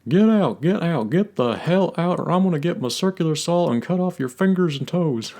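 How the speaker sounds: Hank losing it. Retold or imitated. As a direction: explode after holding it in. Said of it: "he eventually just loses it".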